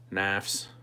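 "Naffs" said to rhyme with laughs; knives rhymed with laughs. Shown as a demonstration. Explaining a viewer's name, "Naffs" rhyming with laughs. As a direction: say "Naffs" with the short vowel of laughs. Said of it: "rhymes with laughs".

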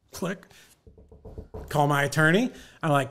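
ordinary delivery

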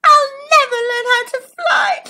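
hammed-up tearful ladybug; upset sniffing. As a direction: cry it out in frustration. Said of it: "sniffed Ladybug".

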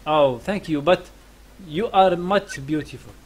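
ordinary talk

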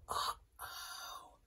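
worried whisper. Acted. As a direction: breathe it out in alarm. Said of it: "whispered Jack".

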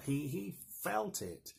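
ordinary delivery

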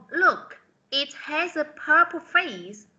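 slow teacher read-aloud; slow model reading. Shown as a demonstration. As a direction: read it out slowly and brightly. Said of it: "teacher reads the model sentence".

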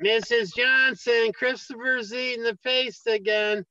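high, slow whiny schoolkid; tattling kid voice. Retold or imitated. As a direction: tattle to the teacher in a drawn-out kid voice.

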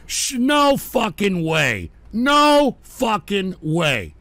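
slow, drawn-out outburst of disbelief; real exasperated outburst; outraged disbelief. Real, not acted. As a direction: drag the words out, indignant and incredulous. Outraged outburst at a played clip.

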